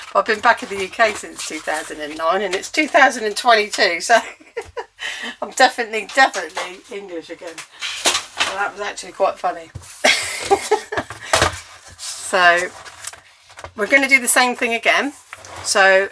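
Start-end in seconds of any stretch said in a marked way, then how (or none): 4.5-5.4 high, quiet giggle; short high-pitched laugh, much softer than speech; real laugh. Real, not acted. Crafter amused at own failed Aussie accent, real giggle. 10.0-11.6 high, wheezy giggle; helpless high laugh with little voice in it; real laugh. Real, not acted. Crafter tickled by own accent slip, real giggling.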